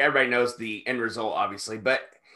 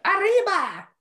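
high, excited whoop; playful high-pitched yell; mock wrestler cry; 4 dB louder, slower than usual. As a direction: cry it out high like a luchador's catchphrase. Mimicking wrestler's catchphrase cry.

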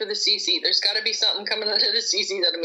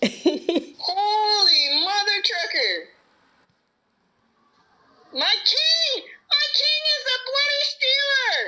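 very high, wailing shriek; screaming crying fan; 10 semitones higher, slower than usual. Real, not acted. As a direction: wail at the screen in laughing despair. Shrieking at the game.

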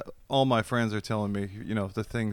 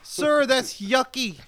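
put-on high, loud kid holler, drawn out; ten-year-old heckler; 14 dB louder, much slower than usual. Commuter heckling a dog walker, childish yell. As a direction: shout your disgust like a little kid.

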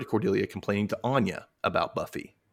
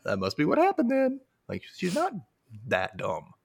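high mocking voice; 8 semitones higher than usual. Mocking Cordelia's thinking.

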